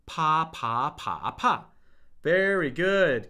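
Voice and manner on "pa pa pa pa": "pa" in quick level, rise, dip, fall sequence; four Mandarin tones. Shown as a demonstration. Teacher recapping the warm-up, four tones run together on "pa". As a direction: chain all four tones on "pa". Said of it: "the four tones of Mandarin Chinese".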